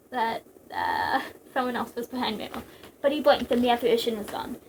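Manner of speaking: ordinary delivery, nothing marked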